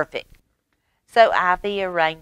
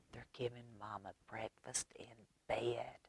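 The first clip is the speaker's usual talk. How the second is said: whisper; 5 semitones lower, 23 dB quieter than usual. Acted. Narrator whispering beside sleeping Mama.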